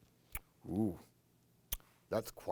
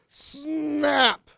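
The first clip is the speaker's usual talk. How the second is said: jaws snapping; 9 dB louder than usual. Acted.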